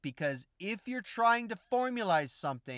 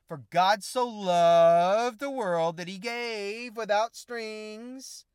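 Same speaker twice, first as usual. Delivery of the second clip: slow, drawn-out scripture quote; preaching tone; 4 semitones higher, 7 dB louder, much slower than usual. Acted. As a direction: stretch each word like a preacher.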